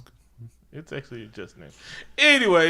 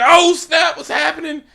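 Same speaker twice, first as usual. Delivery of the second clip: high, loud shocked exclamation; mock crowd gasping in shock; 13 semitones higher, 6 dB louder than usual. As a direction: react like a stunned crowd. Everybody shocked at Wakandan speech.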